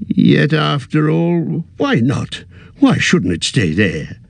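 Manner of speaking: put-on a fussy old hobbit, a little weary; old hobbit voice, tired at heart; Bilbo. Bilbo's voice continues.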